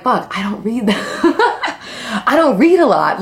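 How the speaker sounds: slow, laughing admission; laughing answer. Real, not acted. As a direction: laugh it off while confessing. Laughs answering the book question.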